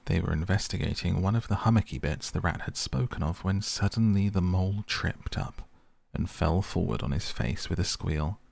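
ordinary delivery, nothing marked

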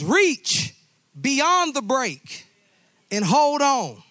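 high, loud, reach stretched out slowly; urgent shout. Acted. Father urging his drowning son to reach, loud high shout. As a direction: belt it like a rescue call. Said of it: "The father says".

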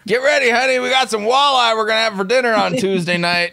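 loud, high, thrilled announcement to his wife; excited call out. Pretend. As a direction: shout the good news to honey. Voicing excited husband calling out.

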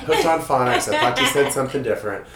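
loud, laughing sorry; laughing through an embarrassed apology. Retold or imitated. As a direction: laugh it off sheepishly.

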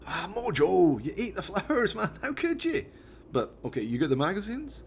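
lightly voiced Scottish mate telling off his chimp; a hint of a Scottish lad; Jones, exasperated driver.

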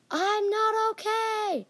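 high, drawn-out yell. Acted. Teammate launched across the gym by a dodgeball, yelled. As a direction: cry it out slowly at a high pitch. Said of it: "he yelled".

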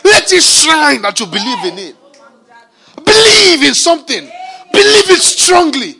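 loud, high, shouted preaching; hammered sermon cries, each Believe shouted; full-force preaching. Acted. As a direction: preach it out with fire.

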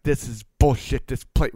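mad, fired up. Retold or imitated. Angry customer complaining about his plate to owner, fired up. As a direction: punch out the complaint, mock furious. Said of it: "he's mad, he's fired up".

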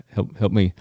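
ordinary talk

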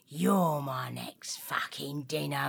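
dog whispering to the parrot; whispered threat. Acted. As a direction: breathe the threat.

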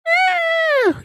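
piercing very high shriek; shrill mock monster scream; alien scream imitation. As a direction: screech like the creature. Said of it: "you just hear the screaming".

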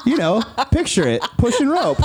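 a hammed-up friend explaining slang; imitating brother. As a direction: pitch it up and spell it out like he's explaining. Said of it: "And he was like".

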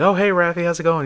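high, breezy imitated hello; friendly greeting. Retold friendly greeting by cartoonist.

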